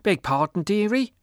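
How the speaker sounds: put-on high, loud boozy old woman; drunk old woman. A narrator's reading.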